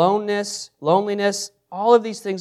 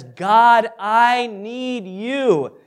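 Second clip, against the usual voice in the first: raised, drawn-out plea; acted desperate prayer, higher and louder; desperate cry to God; 5 semitones higher, 3 dB louder, much slower than usual. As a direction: cry it out slowly, stretching each word. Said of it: "this is a moment of desperation".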